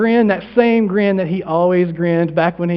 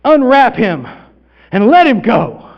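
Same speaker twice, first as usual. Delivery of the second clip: loud, raised order; big, deliberate proclamation; commanding; 4 semitones higher, 6 dB louder, slower than usual. Acted. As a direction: command the onlookers with authority. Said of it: "And he said".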